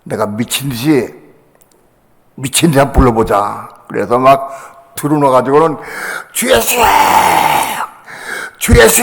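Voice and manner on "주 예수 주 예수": re-enacted desperate high call; crying out loud. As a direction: cry out to the Lord, stretching the last syllable. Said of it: "아주 크게 불렀어".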